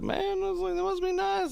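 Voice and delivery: high, teasing that-must-be-nice; wry envy. Retold or imitated. Teasing a rich old coworker, high and wry. Envious remark to rich guy.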